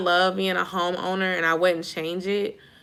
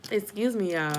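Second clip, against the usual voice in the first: ordinary delivery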